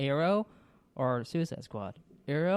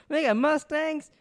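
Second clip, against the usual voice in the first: much higher, loud, hammy cowboy pitchman; cowboy car salesman; 13 semitones higher, 4 dB louder, slower than usual. Pretend. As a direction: hawk your car show like a cowboy. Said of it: "How come I sound like a cowboy".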